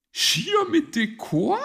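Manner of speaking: high, loud little bird question; curious chirpy youngster; full of curiosity. Acted. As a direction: pipe up wonderingly. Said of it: "fragte Zwitschi voller Neugier".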